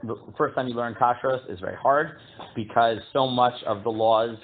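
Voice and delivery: ordinary delivery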